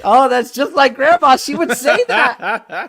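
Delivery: high, loud, gushing astonishment; mock amazed believer. Customer hears psychic describe grandma, mock amazed and excited. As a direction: gush as if totally convinced, voice climbing high.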